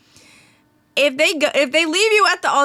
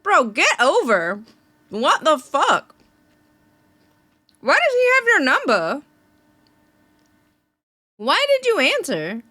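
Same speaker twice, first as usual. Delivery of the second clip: incredulous outrage; 6 semitones higher than usual. Real, not acted. Outraged reaction to the story.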